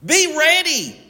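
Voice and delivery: high-pitched preacher's rallying call; high, emphatic exhortation; rousing command. Acted. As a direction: call it out high, urging them on.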